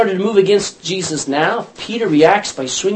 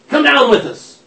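soldier's order; 9 semitones higher, 6 dB louder than usual. Retold or imitated.

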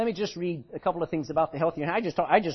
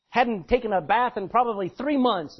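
ordinary delivery

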